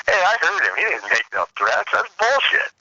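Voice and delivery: retold high-pitched objection; acted-out indignant witness; indignant protest. Friend protests from outside the door.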